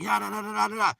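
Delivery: high mock babble of a busy mind; upset chatter. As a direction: drown it out with high, noisy nonsense. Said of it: "you so upset, you so enamored".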